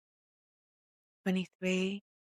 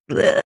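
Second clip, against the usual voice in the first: loud disgusted bleh; 14 dB louder than usual. Retold or imitated. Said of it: "I'm like".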